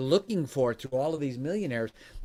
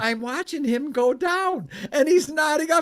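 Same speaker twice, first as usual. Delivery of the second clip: high, loud, laughing disbelief; incredulous excitement; 12 semitones higher, 6 dB louder than usual. Real, not acted. Host recounting a guest dozing off live, incredulous and loud. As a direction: voice climbing to an amused shout.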